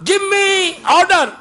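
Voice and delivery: high, slow, loud demand; bossy order. Retold or imitated. Son demanding from his mother, loud high order. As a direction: bark the order at mom.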